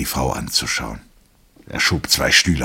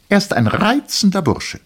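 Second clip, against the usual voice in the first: very high, loud woman's voice; wife praises the lieutenant, high and loud; wife's voice; 7 dB louder than usual. A narrator's reading. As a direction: praise him lightly, high and bright.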